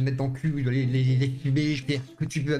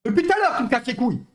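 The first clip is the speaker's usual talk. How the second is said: loud, high, furious; angry shout; 9 semitones higher, 6 dB louder than usual. Real, not acted. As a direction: yell it in his face. Heated quarrel, yelling.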